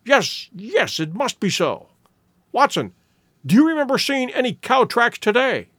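eager, working out a sudden idea; a touch of excitement in the voice; excited cry. A narrator's reading. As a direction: press eagerly toward the question. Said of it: "he cried".

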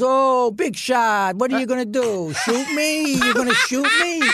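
high, taunting mother impression; mocking mother. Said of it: "And my mother goes".

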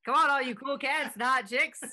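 mock old-time radio DJ hyping the crowd; 1930s radio announcer. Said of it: "a nineteen thirties radio announcer".

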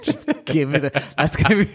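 laughing through the words, slowed; words breaking into laughter. Real, not acted. Laughing at his own joke to his friend.

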